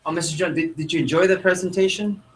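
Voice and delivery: high-pitched question with a stammered start; sales question demo. Shown as a demonstration. Trainer models a follow-up question to a customer, high-pitched. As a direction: lift the pitch, stumble slightly into asking.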